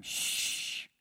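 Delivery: voiceless shushing hiss; hushing. Acted. Crowd leaders silence the blind beggar, shushing.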